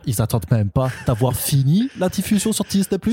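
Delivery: put-on indignant rant in comic Alsatian accent; mock outrage with an Alsace accent; eastern French accent. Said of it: "Si, si je dis accent Arnaud".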